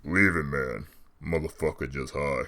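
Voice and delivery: very low, quiet voice; low-pitched, unbothered dismissal; dismissive aside. Acted. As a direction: wave the fight away, low and soft. Friend dismisses the masked kid.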